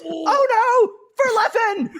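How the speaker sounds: screaming very high, cracking with excitement; Scar's hype call. Retold or imitated. Imitating Scar commentating Mango versus Leffen, high excited scream. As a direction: belt it like a caster losing it. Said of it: "when Scar goes".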